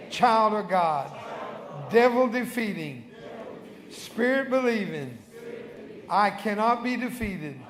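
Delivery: building to loud bold proclamation; exultant preacher declaring victory over the devil; triumphant declaration. Real, not acted. Prayer climaxes in triumphant declaration.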